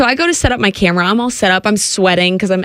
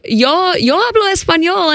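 higher, slow, careful Spanish phrase; speaking Spanish; 8 semitones higher than usual. Retold or imitated. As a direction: try out your Spanish on him, a bit slowly. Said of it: "kinda talking in Spanish with him".

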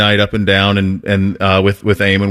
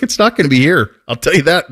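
usual speaking voice

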